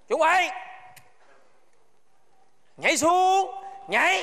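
high, staccato drill order; shouted command. Acted. Guard threatening to shoot stragglers, shouted command. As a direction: bark out the countdown to a jump.

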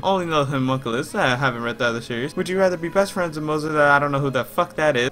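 soft, quick run through questions; rattling off answers under his breath; quiz read-through. Real, not acted. Speed-running quiz quietly.

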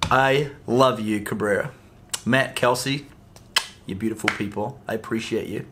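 tender, low thank-you to dear friends; heartfelt thanks. Real, not acted.

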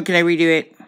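ordinary delivery

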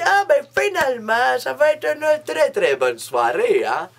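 high, bright hopeful gush to herself; hammed-up delight at the good night ahead; mock excited inner voice. Telling her own thrilled thought about the evening, in mock excitement. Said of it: "je me dis".

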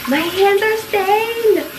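high, loud, drawn-out wail; panicked wail. Real, not acted. Prankster seeing her stained hands, panicked wail. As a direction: wail in dismay, stretching it out.

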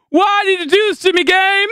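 high, loud wail of betrayal; anguished yell. Real, not acted. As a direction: cry out at the game. Cries out at the game.

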